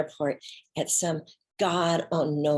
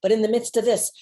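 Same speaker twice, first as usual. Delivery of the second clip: usual speaking voice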